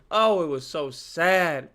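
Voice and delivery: normal talking voice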